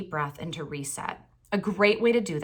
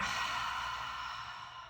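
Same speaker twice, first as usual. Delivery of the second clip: long, soft releasing exhale; audible sigh; 11 dB quieter than usual. Shown as a demonstration. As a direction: sigh all the breath out slowly. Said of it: "and sigh it out".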